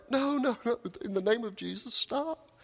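very high, pleading cry without much volume; frantic protest. Acted. Monk running around as the audience laughs, frantic high protest. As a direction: protest desperately, voice leaping up.

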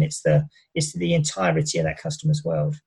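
ordinary delivery

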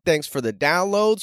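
usual speaking voice

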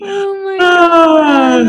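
slow, high, loud laughing wail; crying with laughter. Real, not acted. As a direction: gasp it out through tears of laughter. Overcome with laughter.

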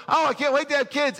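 high, eager gushing; excited parent. Retold or imitated. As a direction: gush about the future. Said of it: "you go".

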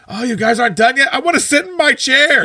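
high, whiny complaint; whiny Joey. Retold or imitated. Joey whines, high-pitched, that he wants to sit in his chair. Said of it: "He's like".

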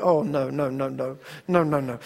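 low, soft string of refusals; mock dread. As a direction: shrink back quietly in mock horror. Acted dread seeing junior high kids.

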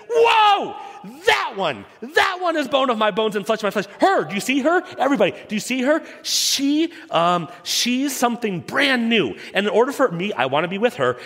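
high, fast, breathless excitement; giddy fifteen-year-old crush. Shown as a demonstration. Preacher acts Adam seeing Eve as a giddy teen. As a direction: gush like a teenager seeing their crush. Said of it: "with that kind of voice, he goes like this".

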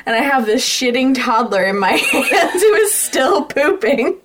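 normal talking voice

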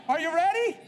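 "Are you ready?" shouted high and loud; loud hype call. Real, not acted. Preacher shouting "Are you ready?" to the congregation. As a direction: yell "Are you ready?" like firing the gun.

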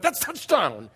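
loud, jumping-up exclamation; hammed-up excited cheer; excited dad's cry. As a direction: cry it out like a thrilled parent. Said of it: "Brendan jumped up and went".

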